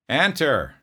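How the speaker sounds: loud, high shout of "Enter"; barked order. Retold or imitated. Drill sergeant yelling at a knocking private, loud bark. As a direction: bellow it through the office door. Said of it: "I screamed out".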